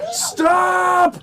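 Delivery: high, forceful yell; commanding shout. Acted. Man tackles the necklace wearer, shouting in command. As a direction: yell it as an urgent order. Griff tackles, orders her to stop.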